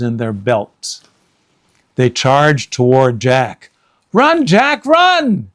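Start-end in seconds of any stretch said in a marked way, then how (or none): 4.1-5.5 high, loud desperate cry. Acted. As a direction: urge him on in panic. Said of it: "cried Annie".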